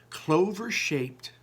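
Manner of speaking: usual speaking voice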